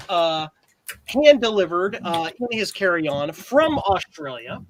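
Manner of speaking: usual speaking voice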